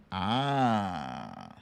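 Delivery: usual speaking voice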